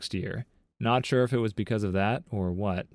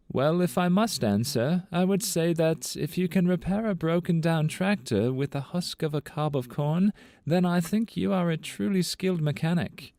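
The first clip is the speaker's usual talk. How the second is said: higher, posh British-cartoon Ferb impression; 6 semitones higher than usual. Answering the hillbilly classmate as Ferb from Phineas and Ferb, in Ferb's British voice. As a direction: answer like a polite British boy. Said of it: "in the voice of Ferb from Phineas and Ferb".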